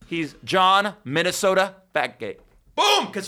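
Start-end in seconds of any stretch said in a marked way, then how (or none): 2.7-3.1 triumphant shout. Real, not acted. Triumphant reveal of nickname.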